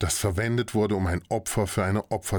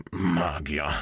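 through gritted teeth; 3 semitones lower than usual. Acted. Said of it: "stieß Torvald zwischen zusammengebissenen Zähnen hervor".

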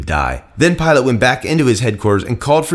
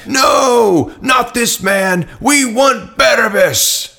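crowd shouting; 12 semitones higher, much slower than usual. A narrator's reading. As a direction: yell it out as an angry mob. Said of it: "they shouted back".

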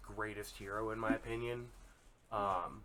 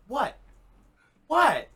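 rising, louder baffled "what"; second one much higher and louder; confused Damian; 10 semitones higher, 10 dB louder than usual. Retold or imitated. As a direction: play the kid genuinely confused why everyone's upset. Damian confused, acted quote.

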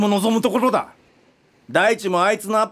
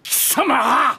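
high, raging yell; enraged shout; furious shout; 5 semitones higher than usual. Acted. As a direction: roar at her. Director lunges in rage.

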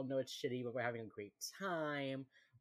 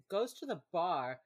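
normal talking voice

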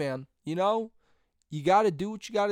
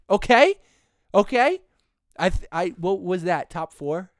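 usual speaking voice

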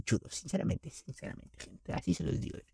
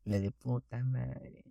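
ordinary talk, nothing marked

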